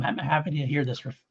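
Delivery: normal talking voice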